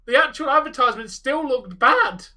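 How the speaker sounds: shouting no in protest; loud. Real, not acted. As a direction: yell it down hard. Heated disagreement.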